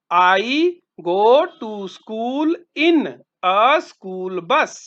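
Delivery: drawn-out word by word, dropping at the end; very slow repeat. Shown as a demonstration. Teacher repeating the answer for students, very slow. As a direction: spell it out slowly for the class. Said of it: "ठीक है, मैं school school बस में जाता".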